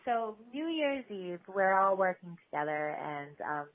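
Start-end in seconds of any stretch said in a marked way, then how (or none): none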